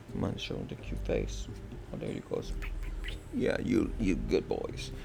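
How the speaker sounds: soft, low coaxing of a dog; hushed praise for a good dog; doggy voice. Real, not acted. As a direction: murmur sweetly to the pet. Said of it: "talk in the doggy voice".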